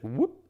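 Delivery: quick upward-swooping whoop; imitated whoop. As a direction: voice the jump as a rising whoop. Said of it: "it's like".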